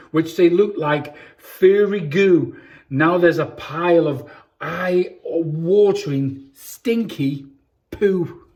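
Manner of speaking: put-on lively storybook reading for kids; reading to children. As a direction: read it like bedtime story time for little ones. Reads picture book to children.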